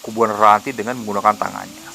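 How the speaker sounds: usual speaking voice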